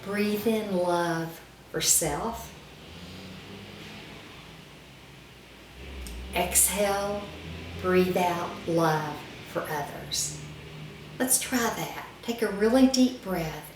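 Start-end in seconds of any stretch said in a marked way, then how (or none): 0.0-10.4 slow, drawn-out breathing mantra; guided breathing mantra. Shown as a demonstration. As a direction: pace the words to a long breath. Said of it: "To breathing, taking a deep breath and thinking".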